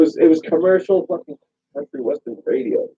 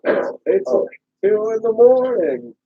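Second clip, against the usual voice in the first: mock caller voice; 9 semitones higher, slower than usual. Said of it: "caller voice bit".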